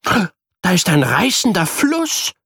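put-on boy shouting in play; calling out. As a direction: point and call out the river. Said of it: "ruft Luca".

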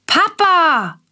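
put-on high, loud call of a small boy; child calling out. A narrator's reading. Willi calling Papa back from bed, high and loud. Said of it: "ruft Willi".

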